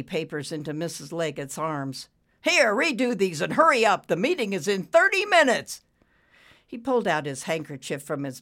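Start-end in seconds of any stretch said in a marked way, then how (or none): 2.4-5.8 loud, high-pitched commands; impatient order. A narrator's reading. Boss to secretary before meeting, impatient order. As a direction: hurry her along sharply.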